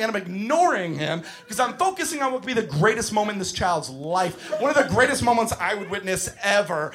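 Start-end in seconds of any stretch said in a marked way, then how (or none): none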